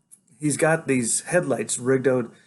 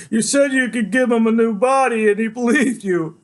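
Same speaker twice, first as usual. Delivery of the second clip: loud, high, indignant outburst; betrayed boy, voice raised high; anguished accusation; 12 semitones higher, 7 dB louder than usual. A narrator's reading. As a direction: cry it out, blaming the doctor.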